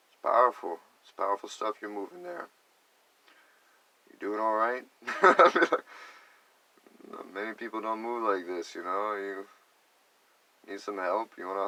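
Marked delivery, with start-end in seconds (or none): 5.1-6.1 bright laugh. Real, not acted. Laughing after the story.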